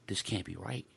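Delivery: soft stunned disbelief; stunned murmur. Retold or imitated. Reading the card, soft stunned disbelief. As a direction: murmur it as if you can't believe it. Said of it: "I'm like".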